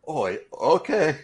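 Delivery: agreeing with dread; reluctant agreement. Retold or imitated. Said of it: "I'm like".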